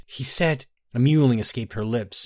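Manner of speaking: usual speaking voice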